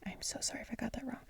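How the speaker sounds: soft quick whispered apology; whispered aside. Real, not acted. Reader whispering an apology mid-story, whispered. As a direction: whisper the apology under your breath.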